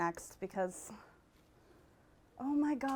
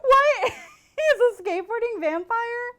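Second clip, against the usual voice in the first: laughing disbelief; 12 semitones higher, 6 dB louder than usual. Real, not acted. Rereading the ad, disbelief.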